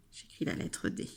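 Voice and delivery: ordinary talk, nothing marked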